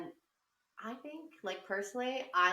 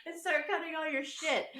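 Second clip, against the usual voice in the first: much higher, slow taunting; high mocking taunt; 12 semitones higher, slower than usual. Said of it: "And I was like".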